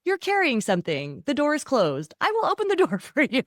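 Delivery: bright, high, eager helper voice, rising to a laugh; cheerful servant's offer, hammed up with a laugh; eager to serve. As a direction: play the overly eager helpful spouse. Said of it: "This is the attitude like".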